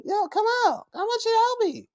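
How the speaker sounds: very high, eager put-on voice; bubbly coaxing. Wrestler asking her rival to help, high and bubbly. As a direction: coax in a squeaky, cheery pitch.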